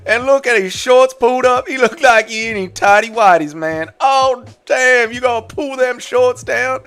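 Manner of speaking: hyped Louisiana commentator impression. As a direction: call the fight in a put-on Louisiana accent. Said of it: "in my Louisiana voice".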